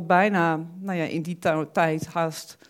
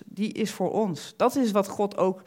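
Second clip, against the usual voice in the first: normal talking voice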